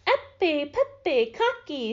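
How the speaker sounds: high, punched-out spell words; magic incantation. A narrator's reading. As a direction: chant each nonsense word crisply. Dorothy recites the magic charm.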